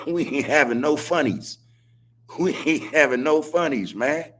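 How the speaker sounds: laughing through the line. Real, not acted. Host laughing while talking to the chat. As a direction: chuckle out the words. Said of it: "I'm laughing".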